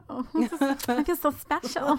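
high, giggly mock swoon; squealed up high with a laugh; gushing delight. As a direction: act out being thrilled and flattered. Acting out her flattered reaction.

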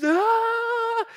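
long, very high imitated wail; mad child's wail. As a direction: wail like an upset kid. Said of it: "she is so mad".

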